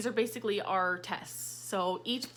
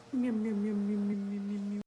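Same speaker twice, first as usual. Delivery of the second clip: cat imitation. Imitating a cat at her pet.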